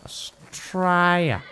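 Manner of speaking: very slow, stretched Australian vowels; Australian accent. Shown as a demonstration. Showing how town names sound Australian, exaggerated Australian drawl. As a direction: draw it out in broad Australian.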